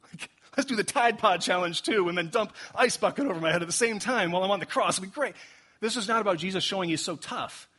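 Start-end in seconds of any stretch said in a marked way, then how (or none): 0.0-5.4 high, loud, hammed-up gung-ho excitement; over-the-top fake enthusiasm; mock bravado. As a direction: sarcastically play an eager show-off, pitched way up. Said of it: "He's not like".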